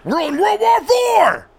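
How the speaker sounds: high screamed impersonation, drawn out; Sam Kinison as Alex Jones. Acted. Host mimicking a comic's conspiracy rant, screamed. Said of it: "Sam Kinison doing an Alex Jones impersonation".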